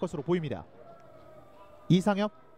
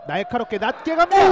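loud rising play-by-play; excited call; 3 semitones higher, 8 dB louder than usual. Real, not acted. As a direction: call the cross with urgency. Dangerous cross call.

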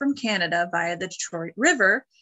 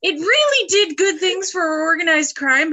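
sarcastic; 9 semitones higher, 6 dB louder than usual. Real, not acted. Sarcastic remark.

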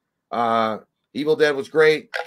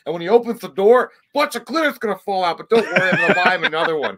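higher, hyped fake-cheerful vlogger voice; mock prank YouTuber; 6 semitones higher, 4 dB louder than usual. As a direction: ham up a clueless prank influencer.

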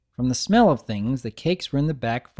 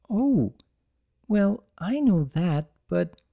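shy mare afraid of seeming rude; Fluttershy voice; 6 semitones higher, slower than usual. Acted. Shy Fluttershy to Big Mac, timid higher voice.

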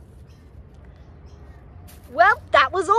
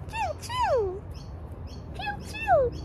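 toy train; 8 dB quieter, much slower than usual. Pretend.

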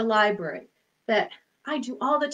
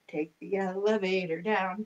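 low and soft going down; 6 semitones lower, 8 dB quieter than usual. Shown as a demonstration. Elevator rhyme, voice goes down.

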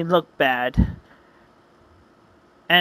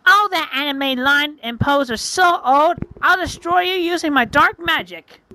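much higher scornful witch voice; mocking witch; 10 semitones higher than usual. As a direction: sneer at the hero as the witch. Witch mocks the hero.

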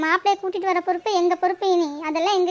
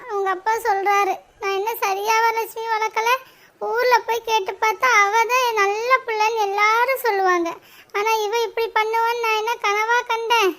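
slow, drawn-out weepy complaint; tearful lament; 3 semitones higher than usual. Acted. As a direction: plead through tears as a blamed mother. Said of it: "ஐயோ கோமதி, எதுக்கு அழுகுற?". (அழுது புலம்பும் குரல்)

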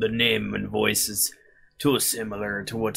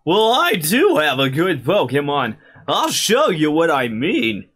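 put-on cocky kid voice; 6 semitones higher, 7 dB louder than usual. Voicing player reply to rival.

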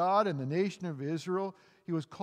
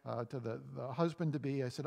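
ordinary talk, nothing marked

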